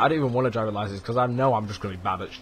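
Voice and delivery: ordinary talk, nothing marked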